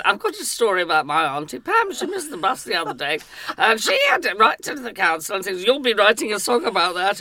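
mock fan anecdote. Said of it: "it's when somebody comes up and says".

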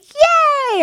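put-on high, gleeful childlike yay; excited cheer. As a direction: squeal it like a happy kid. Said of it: "I remember I was like".

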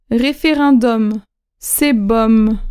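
slow référendum, with the M held at the end; référendum drawn out, M sounded; slow and clear. Shown as a demonstration. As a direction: teach it slowly, ending on the M sound. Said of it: "final M shown".

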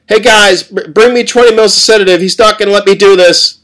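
loud, high call for help across the room; urgent nurse calling. Acted. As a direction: shout for backup, flustered. Nurse calls for sedative.

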